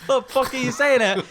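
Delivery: high, loud laughing outrage; mock outrage. Real, not acted. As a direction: act shocked and offended.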